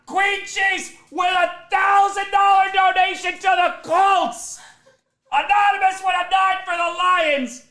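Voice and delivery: high, loud hype read; hype announcer. Real, not acted. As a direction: shout it out like a hype man. Shouted hype donation read.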